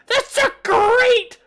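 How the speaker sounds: high, slow, shouted goblin shriek; thrilled goblin. Acted. Pibble loves the new shop name, shrieking with excitement. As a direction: scream it out with manic excitement.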